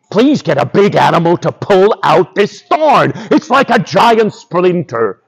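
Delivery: complaining lion with sudden cries of pain; hammed-up wounded lion, roaring and whining.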